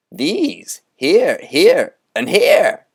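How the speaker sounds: Moundstrout preaching. Acted. Conjurer rousing the boys to build a cathedral, grandly. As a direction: build it up like a fiery sermon on cathedrals.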